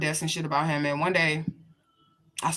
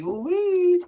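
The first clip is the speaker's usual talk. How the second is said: sharp high whoop of mock sympathy; whooping exclamation; 12 semitones higher than usual. Real, not acted. Reacting to someone getting beaten.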